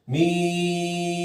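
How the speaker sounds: मीम sung out very slow and steady; teacher models a long meem; elongated letter name. Shown as a demonstration. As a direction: hold मीम long without wavering. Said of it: "सीधी सीधी जाए".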